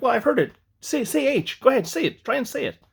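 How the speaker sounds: imitating a kid egging someone on, high-pitched; pushy schoolmate's jeer, retold high; teasing goad. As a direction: goad them in a high, insistent mock voice. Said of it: "as a joke, he's like".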